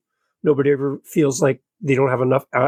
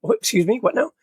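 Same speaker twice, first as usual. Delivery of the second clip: surprised; 7 semitones higher than usual. Retold or imitated. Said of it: "I'm like".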